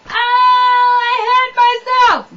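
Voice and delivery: loud, high, drawn-out mock wail; hurt child wailing. Jimmy to parent after falling off the swing, wailing. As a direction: wail like a kid who fell.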